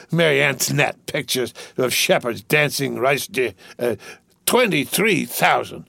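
put-on slurred, giggly drunk rich boy. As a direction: play a rowdy drunk young gentleman. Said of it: "I'm drunk".

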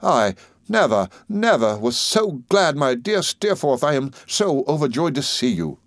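slow, heartfelt joy, pitch lifted; thrilled to see a dear friend, drawn out; overjoyed greeting. Acted. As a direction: savour every word of a joyful reunion.

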